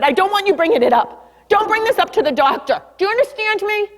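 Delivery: retold stern warning, pitch climbing; high, insistent acted scolding; angry. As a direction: imitate an upset mom laying down the law. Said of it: "I know anger is a secondary emotion".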